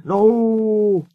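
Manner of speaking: long, high plea; high, distressed protest; pleading. A narrator's reading. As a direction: plead against it, drawing it out. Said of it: "pleaded Skarloey".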